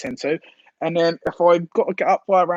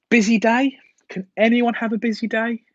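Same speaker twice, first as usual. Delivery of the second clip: higher, puzzled rhetorical question; incredulous questioning; 8 semitones higher, 4 dB louder than usual. Real, not acted. Streamer querying an app notification's wording, incredulous.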